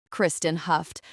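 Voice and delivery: usual speaking voice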